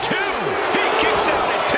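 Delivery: high, loud near-fall call; excited count call. Real, not acted. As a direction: ride the tension of the count.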